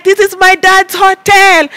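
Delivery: high, slow proud announcement; grand boast. Retold or imitated. Bragging to everyone at the pool, high slow boast. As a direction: proclaim it like you own the place.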